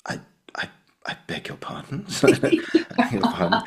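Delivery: very soft, barely voiced disbelief; Oliver Reed. Acting teacher reacts to the student's overblown line, hushed and incredulous. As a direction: murmur it, halting, as if taken aback. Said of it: "Oliver Reed just goes".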